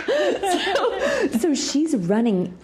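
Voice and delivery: talking through a real laugh. Real, not acted. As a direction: still laughing as she picks the story back up. Laughing after being startled.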